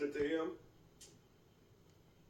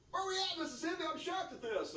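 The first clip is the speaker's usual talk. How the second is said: mock shock; 10 semitones higher than usual. Mock calling out Mississippi.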